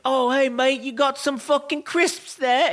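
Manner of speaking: mock British bloke, high and slow; lightly hammed-up British accent asking for crisps. Wrestler imitating British rivals in a British accent. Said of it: "they're like".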